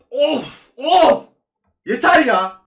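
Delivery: loud, high pained groans of despair; Of! cried out loud and high; anguished groan. Real, not acted. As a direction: groan out in real frustration. Player loss reaction.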